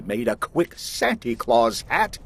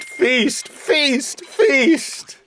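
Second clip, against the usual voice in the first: each feast higher; climbing repeats; 11 semitones higher, much slower than usual. Acted. Grinch mocking the Whos' feast, climbing higher. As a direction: rise up on every feast, exasperated.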